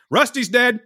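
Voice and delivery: high, blunt announcement from the doorway; mom's blunt news. Retold or imitated. Mom bluntly telling her son the dog died, high blunt delivery.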